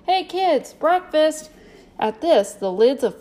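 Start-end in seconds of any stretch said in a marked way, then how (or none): 0.1-1.5 high, loud summoning call. A narrator's reading. Clown cups hands and summons family to breakfast, calling out. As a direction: call the kids in from a distance. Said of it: "Sam Treat called".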